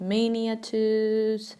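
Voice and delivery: miniatures as MAY-nia-toos, drawn out; wrong pronunciation. Shown as a demonstration. Teacher rejecting another wrong miniatures, slow may-nia-toos. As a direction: miniatures slowly, starting with MAY. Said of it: "not miniatures".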